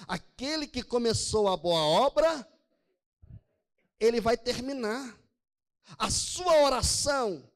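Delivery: slow, loud pulpit preaching; forceful preaching. Acted. Pastor preaching to his congregation, slow and loud. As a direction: preach it out with conviction.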